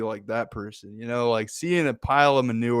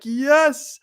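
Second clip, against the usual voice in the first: much higher, ecstatic squeal of joy; thrilled yes; 4 dB louder than usual. Acted. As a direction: squeal yes like a kid who hit the jackpot. Optimist twin thrilled by manure.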